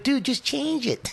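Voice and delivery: high, drawn-out weaselly coaxing; weaselly pleading. Retold or imitated. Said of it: "Little weasel I am".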